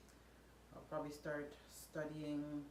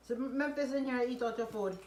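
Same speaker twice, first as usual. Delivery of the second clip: loud, stern telling-off; firm parent, raised voice; scolding; 7 semitones higher, 9 dB louder than usual. Real, not acted. Scolding a child over food.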